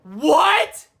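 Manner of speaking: loud high outraged yell; shouted what. Retold or imitated. Shocked fan in the theater at the ending, loud yell. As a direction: yell it at the top of your lungs. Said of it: "I literally said at the top of my lungs".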